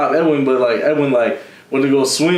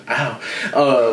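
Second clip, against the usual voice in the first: ordinary delivery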